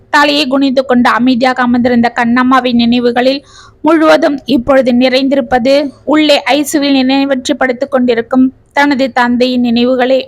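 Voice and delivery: usual speaking voice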